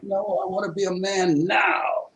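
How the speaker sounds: pressing hard on now; insistent protest. Retold or imitated.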